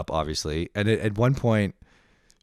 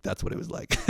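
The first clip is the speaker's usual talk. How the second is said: whispered aside; 9 dB quieter than usual. Retold or imitated. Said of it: "And he was like".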